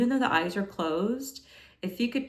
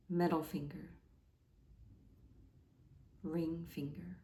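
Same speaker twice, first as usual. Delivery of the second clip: hushed yoga nidra guidance, fading softer; guided meditation; 5 dB quieter than usual. Acted. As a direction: guide them into relaxation, growing quieter with long pauses. Guides a body relaxation.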